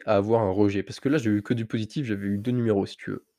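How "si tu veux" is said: ordinary talk, nothing marked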